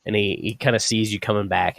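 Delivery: usual speaking voice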